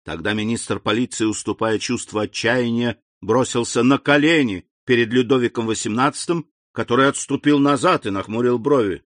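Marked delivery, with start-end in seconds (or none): none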